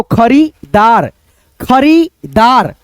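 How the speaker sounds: खरीदार drawn out slowly; slow reading. Shown as a demonstration. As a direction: read खरीदार syllable by syllable. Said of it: "धीरे धीरे पढ़ना". (धीरे धीरे पढ़ना)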